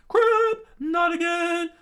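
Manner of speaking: very high, very loud alarmed yell; shocked shout. Acted. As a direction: shriek in dismay. Sanji reacts to cannon shot.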